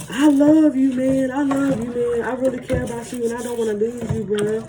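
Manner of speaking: put-on high, loud sloppy drunk declaring love; mock the drunk's mushy overflow; imitating a tipsy guy's sappy I-love-you-man; drunk gushing.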